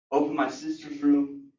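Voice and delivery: normal talking voice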